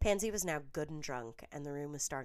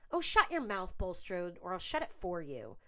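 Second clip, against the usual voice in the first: snapping threat; 4 semitones higher, 6 dB louder than usual. A narrator's reading. Roommates screaming at each other.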